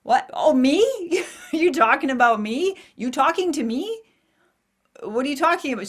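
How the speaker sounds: hammed-up shock, who me; mock bewilderment. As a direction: act out being stunned and confused. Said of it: "I'm like".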